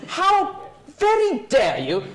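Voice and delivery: indignant gentleman. Acted.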